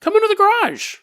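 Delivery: mysterious voice. Retold or imitated. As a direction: lure her in from out of sight. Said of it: "she hears someone say".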